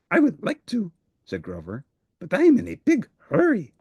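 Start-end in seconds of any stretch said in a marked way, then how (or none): 0.1-0.9 high, eager furry monster voice; a cheerful little monster who wants to help; Grover voice. Acted. Grover speaks to Big Bird. 2.2-3.7 high, eager furry monster voice; a cheerful little monster who wants to help; Grover voice. Acted. Grover's hurried reply.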